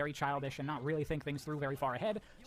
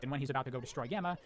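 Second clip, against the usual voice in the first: usual speaking voice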